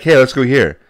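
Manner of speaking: mock eager suggestion. Friend imitating him picking restaurants.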